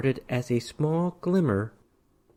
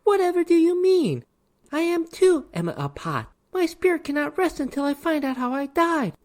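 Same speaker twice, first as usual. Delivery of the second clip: pouting tearful ghost. A narrator's reading. Ghost pouts at the man, high-pitched hurt pleading. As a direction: play the hurt innocent, near tears. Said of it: "She pouted with tears in her eyes".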